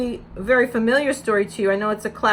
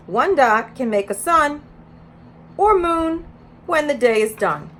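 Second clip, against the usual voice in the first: bright, louder storybook read-aloud; reading to children; 4 semitones higher, 6 dB louder, slower than usual. Acted. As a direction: read it out to a preschool circle. Reads picture book to children.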